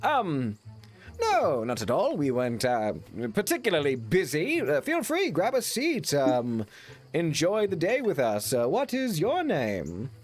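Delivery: hammed-up gracious toff; pompous welcome. Simon welcoming the stranger.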